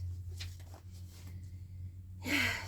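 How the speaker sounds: long breathy sigh out. Real, not acted. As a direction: let out a weary sigh. Tired after appointment.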